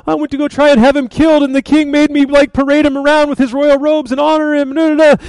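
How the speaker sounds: high, fast griping; mock complaining. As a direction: imitate someone whining about a humiliating day. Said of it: "while he's complaining about it".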